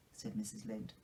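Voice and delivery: animated storybook reading, lifting key phrases; lively read-aloud narration of a classic novel; story narration. A narrator's reading. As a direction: read it like a bedtime chapter, savouring each line. Narration resumes.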